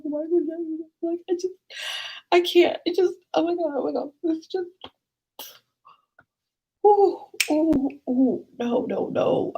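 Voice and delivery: high, wailing sobs, falling apart; hammed-up tearful whine, words breaking up; mock crying. Telling teacher about the death, mock sobbing. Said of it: "Why are you crying?".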